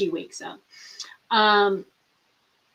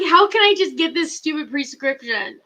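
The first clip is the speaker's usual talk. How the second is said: half-crying with frustration, louder and higher; frustrated; 5 semitones higher, 5 dB louder than usual. Retold or imitated. Patient retelling a denied prescription refill, frustrated wail. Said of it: "I'm so frustrated".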